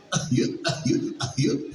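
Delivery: throaty goofy ahiu yelps; hooting cartoon-dog laugh. Shown as a demonstration. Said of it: "un jaloncito hacia la garganta".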